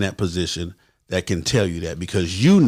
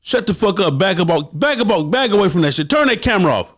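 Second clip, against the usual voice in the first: mock police; 7 semitones higher than usual. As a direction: boss the crowd back like a cop. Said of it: "the police tell you".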